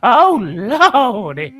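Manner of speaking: high, sung church auntie hollering; churchy auntie. Acted. Auntie character on the phone hollering at folks, high and sung. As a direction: holler it like a Sunday preacher mama. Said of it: "Take us to church".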